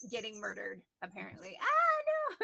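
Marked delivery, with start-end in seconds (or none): 1.6-2.4 very high, loud squealed protest; hammed-up high-pitched wail of distress; mock terror. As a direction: squeal as if being murdered. Voicing kitten supposedly getting murdered.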